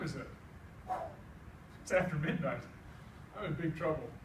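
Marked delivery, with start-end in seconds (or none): none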